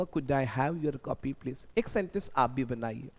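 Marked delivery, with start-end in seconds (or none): 0.0-1.8 slow, clear, a touch soft; slow polite request. Shown as a demonstration. Asking someone for their copy, slow and polite. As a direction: model it slowly for learners. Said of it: "तो बोलूँगा".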